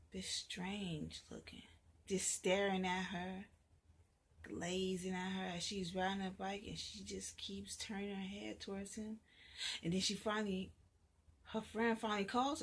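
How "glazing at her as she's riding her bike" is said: usual speaking voice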